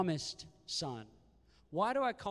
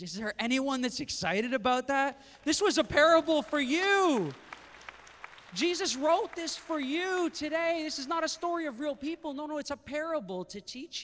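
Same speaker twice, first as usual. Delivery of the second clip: loud, high rallying sermon; a preacher raising the roof, loud and high; full-force preaching; 9 semitones higher, 6 dB louder than usual. Acted. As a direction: fire the congregation up.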